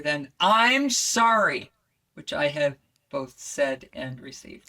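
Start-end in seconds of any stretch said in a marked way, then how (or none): 0.4-1.7 gentle, intimate "I'm sorry"; water voice. Shown as a demonstration. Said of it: "if you have to say you're sorry, this one will probably go better".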